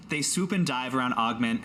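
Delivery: ordinary talk, nothing marked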